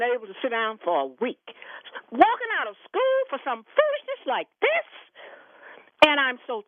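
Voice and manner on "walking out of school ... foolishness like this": much higher, incredulous scoffing question; indignant scorn. Real, not acted. Caller scoffing at students walking out of school, indignant. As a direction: sneer at the foolishness.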